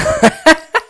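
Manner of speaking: short high burst of laughter; real laugh. Real, not acted. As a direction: laugh out at the name. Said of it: "I'm laughing because".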